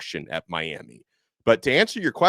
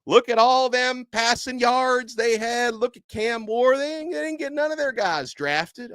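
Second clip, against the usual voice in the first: put-on much higher, slowed Southern Gator coach accent; mock a rival SEC coach in a thick Gator accent; 14 semitones higher than usual. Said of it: "Gator accent to any hypothetical coach".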